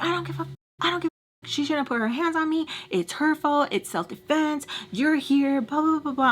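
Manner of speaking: high, mimicked arguing; mock defensive retort. Storyteller as Sam claiming self-defense, high mock-defensive chatter. Sam's retort in retold argument.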